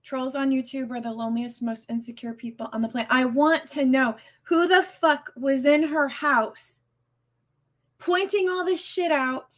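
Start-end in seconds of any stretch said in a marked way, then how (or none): 3.1-9.5 much higher, slow furious demand; angry. Real, not acted. Streamer demanding to know who filmed her friend's home, slow fury. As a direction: drag out every word in fury. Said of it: "I'm so upset at this moment".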